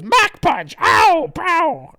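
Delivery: high, loud cartoon fight yell; attack cry. Fighter throws and lands a punch, yelling theatrically. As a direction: shout the move with comic impact noises. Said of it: "And then he goes".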